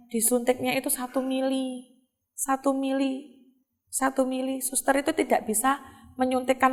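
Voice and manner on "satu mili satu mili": ordinary talk, nothing marked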